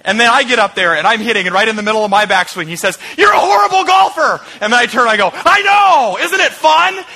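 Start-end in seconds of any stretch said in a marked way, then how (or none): 3.2-4.4 high, loud playful insult; mock heckling shout. Friend to golfer mid-backswing, mock heckling shout. 5.3-7.0 high, loud gleeful agreement; gleeful shout. Retold or imitated. Golfer turning to heckling friend, gleeful high shout.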